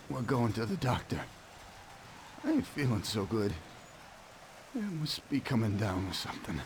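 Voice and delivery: low, ailing voice; sick and weak. Acted. Wounded man admits he feels sick, sick and weak. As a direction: speak as if unwell, pitched low.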